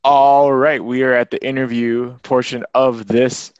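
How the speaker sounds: announcer voice. Real, not acted. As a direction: announce it like a radio host. Announcing the interview segment.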